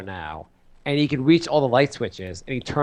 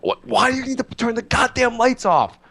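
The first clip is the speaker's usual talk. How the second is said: high, incredulous mock outburst; exasperated parent; 10 semitones higher than usual. Parent to son who switched off lights, exasperated outburst. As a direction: lose patience in a high strained voice.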